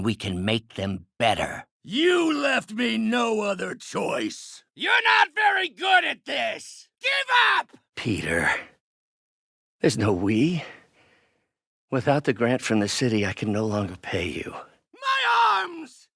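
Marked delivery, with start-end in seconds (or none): 1.8-7.7 high, slow, furious taunts; combat shouting. Acted. Villain taunts in a fight. 14.9-16.1 very high, stretched scream; anguished cry. Acted. Villain cries out in fight.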